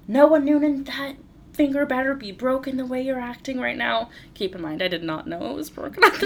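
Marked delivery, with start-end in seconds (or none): 0.1-4.1 higher and louder, full-name warning; stern scolding. Retold or imitated. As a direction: scold your son by his full name. And I'm like.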